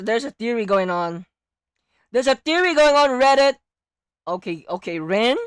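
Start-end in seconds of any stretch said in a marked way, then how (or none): 2.1-3.5 excited. Real, not acted. Excited about theory.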